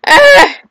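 loud, very high yelp; sudden squawk of frustration. Real, not acted. Player stuck in a game, high frustrated yelp.

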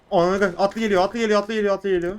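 loud repeated cavalry alarm; cavalry coming, panicked; alarmed warning. Real, not acted. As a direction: shout the warning in fright.

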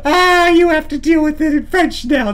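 high, loud, tickled exclamation; highly amused. Real, not acted. Streamer teasing French viewers about his nickname, amused and high. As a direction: crow it straight out of a laugh.

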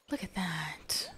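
slow, hushed whisper of awe. Real, not acted. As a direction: whisper it in wonder. Repeats line, whispered then aloud.

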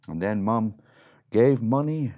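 ordinary delivery

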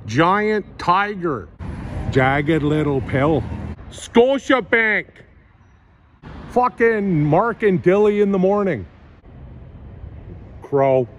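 exaggerated Canadian accent, savouring every word. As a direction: read each sign aloud in a hammed-up Canadian accent. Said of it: "reading signs in my Canadian accent".

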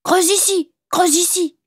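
very high little-dog voice; calling puppy. Acted. Puppy telling its master to dig, high-pitched calling. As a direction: cry it out like a small talking dog. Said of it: "criait le chien".

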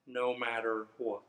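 ordinary delivery, nothing marked

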